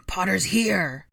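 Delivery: loud announcing shout; proclaiming. A narrator's reading. Rabaston alerts the attackers on entering, proclaiming. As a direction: call it out to the whole band. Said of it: "Rabaston proclaimed".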